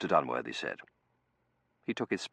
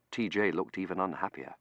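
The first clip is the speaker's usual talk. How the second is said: plain reading voice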